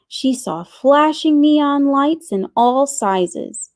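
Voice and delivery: usual speaking voice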